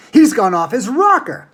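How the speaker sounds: a father shouting in alarm at Wonka; aghast shout. A narrator's reading. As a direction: cry it out in alarm. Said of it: "shouted one of the fathers, aghast".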